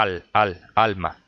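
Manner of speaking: "alma" with the stress on its first syllable, AL-ma. Shown as a demonstration. Teacher showing where the beat of "alma" falls, first-syllable stress. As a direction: repeat the opening "al", then land "alma" on AL. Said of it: "el golpe de voz cae en la primera A".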